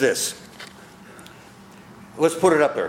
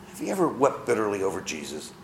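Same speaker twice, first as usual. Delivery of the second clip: "Have you ever wept bitterly over Jesus?" repeated softer and lower; quiet; 5 semitones lower, 6 dB quieter than usual. Shown as a demonstration. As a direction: ask it again gently, inward. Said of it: "just answer this to yourself".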